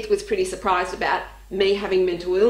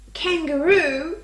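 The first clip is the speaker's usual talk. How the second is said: slow, higher questioning echo; puzzled echo; 5 semitones higher, slower than usual. Retold or imitated. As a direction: echo the word as a drawn-out question. Companion echoes the word puzzled.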